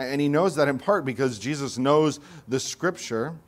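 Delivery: usual speaking voice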